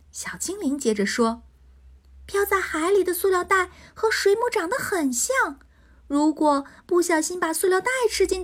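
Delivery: high, kind pixie voice explaining; a little pixie gently advising. Acted.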